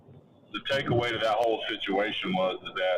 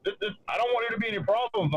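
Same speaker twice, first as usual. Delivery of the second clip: much higher, fussy imitation of Jesse; mock worried Jesse; 11 semitones higher, faster than usual. Retelling Jesse fretting about problems, mock anxious higher voice. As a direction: mimic an anxious man backing off.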